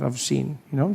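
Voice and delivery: ordinary talk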